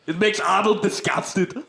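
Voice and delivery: mock Austrian accent; Arnold Schwarzenegger. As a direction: play a disgusted Austrian strongman. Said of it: "can't imagine Arnold Schwarzenegger doing".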